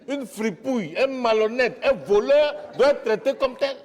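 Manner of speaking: loud, high-pitched denunciation; indignant outburst. Acted. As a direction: denounce him at full voice, outraged.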